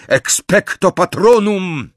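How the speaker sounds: high, loud, drawn-out incantation; shouted spell. A narrator's reading. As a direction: cast it with a slow, forceful cry. Casting the Patronus against attackers.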